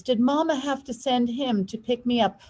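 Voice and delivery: plain reading voice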